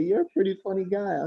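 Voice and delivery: high put-on woman's voice; imitated store lady. Store lady complimenting the driver, high imitated voice. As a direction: raise the pitch to mimic her compliment. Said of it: "she said".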